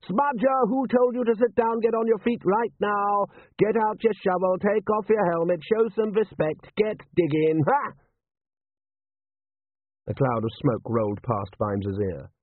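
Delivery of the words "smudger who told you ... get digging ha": high, strained parade-ground yelling on one note; bellowing drill sergeant. A narrator's reading. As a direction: bark orders at idle soldiers. Butler barking orders at soldiers.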